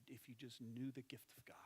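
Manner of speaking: whispered with compassion; hushed, empathetic whisper; tender whisper. Acted. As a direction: breathe it softly, full of longing. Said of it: "empathized, and said".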